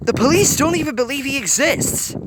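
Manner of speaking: hissed in anger. A narrator's reading. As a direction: lash out at the police. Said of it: "Hiro hissed".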